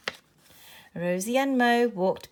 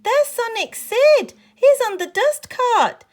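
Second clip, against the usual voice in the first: very high, loud excited yell; shouted; 11 dB louder than usual. A narrator's reading. Girl finding her lost robot, excited shout. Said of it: "she shouted".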